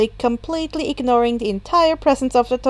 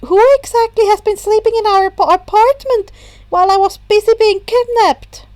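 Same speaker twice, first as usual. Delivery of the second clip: higher, louder, quick disbelieving question; a flustered boy catching up; high, fast Izuku; 8 semitones higher, 6 dB louder than usual. A narrator's reading.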